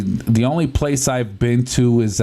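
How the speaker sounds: normal talking voice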